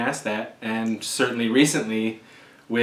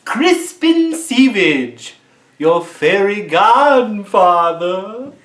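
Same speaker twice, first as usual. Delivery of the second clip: slow hammed-up drawl; 8 semitones higher, 7 dB louder, slower than usual. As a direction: stretch the name slowly, high and loud. Playful over-the-top intro.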